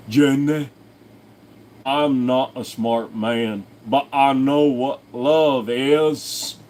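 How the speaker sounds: very slow, earnest Southern drawl; slow simple earnest voice; Forrest Gump line. Pretend.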